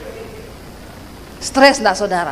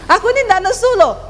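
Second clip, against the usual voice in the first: high, quick protest; cross-sounding denial; 7 semitones higher, faster than usual. Retold or imitated. Wife denies being angry, in a high cross tone. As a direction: deny it hotly, pitch raised. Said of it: "nada wong nesu".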